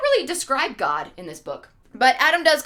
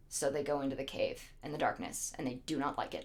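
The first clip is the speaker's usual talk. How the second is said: very low, soft storytelling; hushed aside; 10 semitones lower, 15 dB quieter, faster than usual. Real, not acted. Teller sending them into darkness, low and hushed. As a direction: drop right down for a grim little aside.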